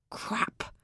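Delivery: a low whispered curse under the breath; muttered. A narrator's reading. Stranded in the dead car, muttering a curse. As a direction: mutter it to yourself, barely voiced. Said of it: "I muttered".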